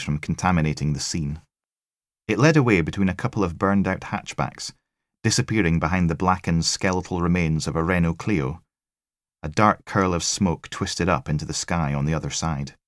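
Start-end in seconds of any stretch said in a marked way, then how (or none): none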